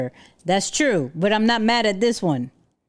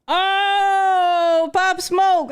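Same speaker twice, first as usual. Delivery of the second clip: higher, louder burst of delight; light up at a favourite song; excited recognition; 9 semitones higher, 4 dB louder, much slower than usual. Real, not acted. Streamer hears [name] play, excited higher shout.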